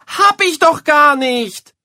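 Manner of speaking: very high, loud, slow yell of denial; shouting boy. A narrator's reading. Boy yelling at his mother, furious denial. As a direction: scream the denial.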